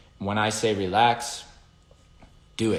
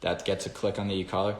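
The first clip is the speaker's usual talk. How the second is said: ordinary delivery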